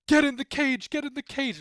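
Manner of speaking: high, loud urgent pleading; cheering on a character from the couch, high and loud; urgent urging. Retold or imitated. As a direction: yell it at the screen like a fan. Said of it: "first thing I'm thinking".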